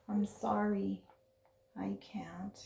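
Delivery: small whispered apology. Acted. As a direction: whisper it, sorry and defeated. Ricky sadly refuses to roll.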